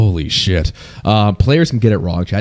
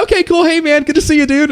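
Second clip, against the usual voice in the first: high-pitched polite brush-off; awkward fake friendliness. Streamer playing it off with a stranger, high awkward friendliness. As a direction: play it off with overdone high cheer.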